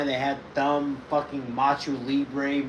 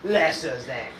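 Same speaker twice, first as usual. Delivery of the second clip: loud hammed-up French accent; haughty mock-French with a theatrical laugh; 5 dB louder than usual. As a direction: declaim it as a pompous fake Frenchman. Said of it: "oui, oui, French accent".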